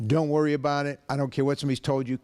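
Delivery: normal talking voice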